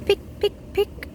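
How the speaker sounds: whispered high pick pick pick; tiny pecking sound. A narrator's reading. Egg sound effect in story.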